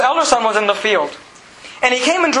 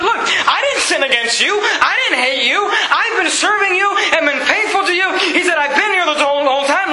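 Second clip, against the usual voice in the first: indignant elder son; 7 semitones higher than usual. Retold or imitated. Elder son complaining to his father, mock indignant. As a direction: play the wronged faithful son.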